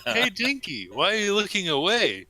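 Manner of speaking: put-on high, playful girl voice; teasing girl voice.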